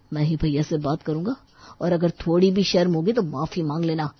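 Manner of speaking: ordinary talk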